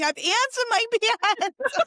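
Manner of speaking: very high mock scream breaking into laughter; hammed-up high-pitched plea with a laugh; screaming, begging. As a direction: squeal it like a begging kid, laughing. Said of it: "I'm running around screaming, like, begging someone".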